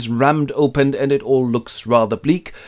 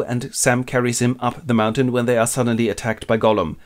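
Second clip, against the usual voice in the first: normal talking voice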